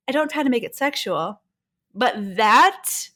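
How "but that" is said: ordinary delivery, nothing marked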